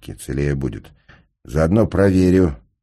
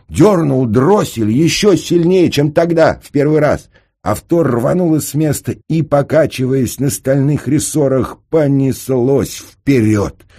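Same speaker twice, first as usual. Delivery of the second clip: high, loud, thrilled storytelling; breathless action narration; 11 semitones higher, 7 dB louder than usual. A narrator's reading. Narrator tells of the car tearing off, high and excited. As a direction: tell it at a racing pitch of excitement.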